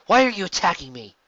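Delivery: high, loud demand; demanding shout. A narrator's reading. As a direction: call out the attack. Hero confronts attackers.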